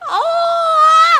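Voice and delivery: very high, loud, drawn-out "oh"; touched squeal. Real, not acted. Streamer hearing a viewer loves them, touched high squeal. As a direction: squeal as if overcome by sweetness. Touched by a loving birthday message.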